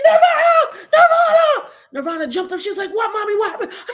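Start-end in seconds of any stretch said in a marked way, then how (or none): 0.0-1.7 high, loud reenacted scream; acted excited shriek. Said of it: "I started screaming". 2.9-3.7 high-pitched acted startled questions; urgent, high retold reply; alarmed daughter. Daughter startled awake by the screaming.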